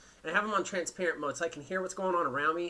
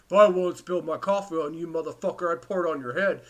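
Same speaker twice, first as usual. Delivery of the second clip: a louder, put-on voice of the rude lady threatening; angry bus lady's voice; 6 dB louder than usual. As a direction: threaten like the big lady on the bus, in a full mock voice. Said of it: "I'll do it in her voice".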